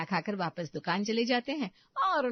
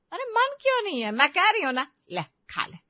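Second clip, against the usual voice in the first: high, loud coaxing demand; forceful insistence; 10 semitones higher, 7 dB louder than usual. Acted. Mother-in-law pressing snacks on bahu, high loud insistence. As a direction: push her to eat, high and loud.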